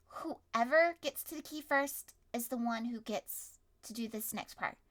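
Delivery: ordinary talk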